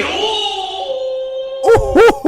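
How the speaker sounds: very high drawn-out hooting; mock wowed whoop. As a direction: whoop like an impressed onlooker, hammed up. Acts colleague seeing the photo.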